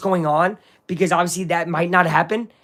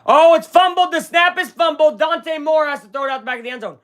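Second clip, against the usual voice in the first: excited; 9 semitones higher, 3 dB louder than usual. Real, not acted. Live fumble reaction.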